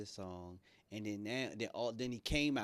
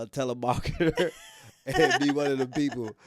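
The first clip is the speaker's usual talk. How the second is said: laughing; 2 semitones higher, 12 dB louder than usual. Real, not acted. Laughing mid-sentence.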